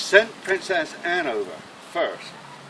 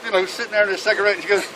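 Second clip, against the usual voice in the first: normal talking voice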